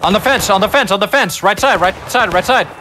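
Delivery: shouting callouts. Real, not acted. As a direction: call positions out over gunfire. Calling out enemy positions in battle.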